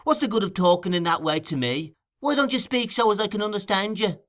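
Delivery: irritated demand. Acted. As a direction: demand it, fed up with the slang. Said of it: "demanded Mister Bolter".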